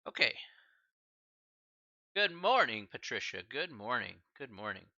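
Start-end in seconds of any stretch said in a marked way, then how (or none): none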